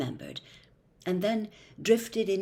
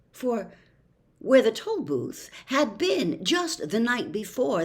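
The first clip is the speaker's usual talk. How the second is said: usual speaking voice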